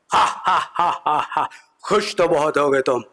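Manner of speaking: mock villain cackle into the taunt; Gabbar laugh imitation. Gabbar laughs at the comedian, hammed-up villain cackle. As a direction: ham up Gabbar's laugh and sneer. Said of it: "Gabbar Singh बैठे हुए थे".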